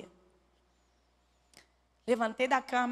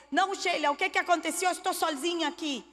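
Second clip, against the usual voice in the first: much higher, louder exasperated protest; irritated; 7 semitones higher, 3 dB louder, faster than usual. Retold or imitated. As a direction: push her off, fed up with questions. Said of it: "Irritada, eu falei". (irritada, bem mais alto e aguda)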